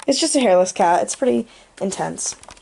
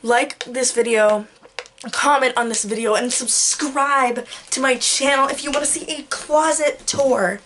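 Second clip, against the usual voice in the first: slow, careful YouTuber sign-off; slow deliberate outro; 2 semitones higher than usual. Shown as a demonstration. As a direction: spell out the outro slowly. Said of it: "No, that wasn't good".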